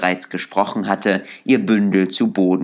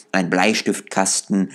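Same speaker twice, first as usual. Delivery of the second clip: plain reading voice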